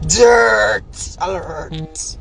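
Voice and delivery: very slow, wailing shout; drawn-out wailing alarm; wailing mock alarm. Real, not acted. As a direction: cry it out like a siren. Mocking rant at rival.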